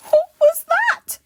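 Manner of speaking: very high, loud shriek of alarm; terrified shriek. Acted. Mister Jelly hears a twig snap, terrified shriek. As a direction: shriek it as if jumping in fright. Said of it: "he shrieked".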